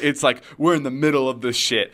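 normal talking voice

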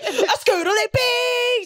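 high, drawn-out singsong squawk; mock squawking. Friend mocking a daughter and dad at the funeral, squawking. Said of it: "just, like, squawking right next to her".

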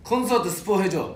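ordinary delivery

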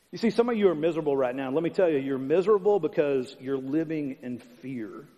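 usual speaking voice

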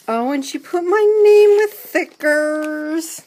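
loud, high excited exclamation; high-pitched, loud delight. Real, not acted. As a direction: squeal it out, thrilled by the gift. Delighted at finding her name on gift.

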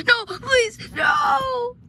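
loud, high cry of terror; panicked shouted plea; terrified pleading. Acted. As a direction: beg at a shout, building to a wail. Begs the creature to stop.

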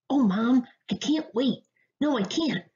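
put-on high-pitched, impatient young mouse; overexcited cry. A narrator's reading. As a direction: plead with Mom like an overexcited little one. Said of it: "cried the overly excited young mouse".

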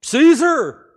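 a loud, high shout of the name; crowd crying out. Acted. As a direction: call it out like a whole crowd answering. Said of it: "the people cry up".

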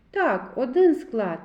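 ordinary talk, nothing marked

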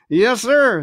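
put-on gruff old sea cook hiding his annoyance; Silver's voice.

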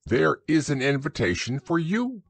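a proper, slightly pompous owl; Owl's voice. A narrator's reading. Owl explains the invitation.

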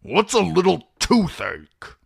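put-on irritable old man, building from grumble to loud high outburst; snapping. A narrator's reading. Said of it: "Kreb snapped".

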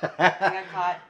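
laughing. Real, not acted. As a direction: caught out, laughing. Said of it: "So [name], I can hear you eating".

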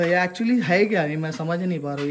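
ordinary talk, nothing marked

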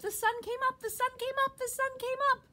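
very high, excited little squirrel; chirping squirrel. A narrator's reading. Happy squirrel announces the sunrise, chirped very high. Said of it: "he chirped".

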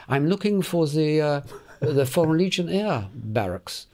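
imitating a young German speaking English; German accent. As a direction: put on a German accent, groping for the words. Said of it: "I knew he was German by his accent".

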